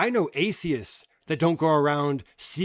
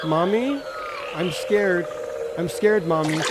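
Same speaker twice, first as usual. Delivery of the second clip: put-on scared child. Mocking a scared kid calling to Mommy. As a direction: whimper like a frightened child.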